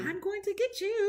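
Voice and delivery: high, creepy mock threat; witch voice. Guest plays creepy witch, high taunting voice. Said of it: "Witches, yeah".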